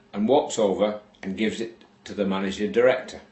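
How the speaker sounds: ordinary talk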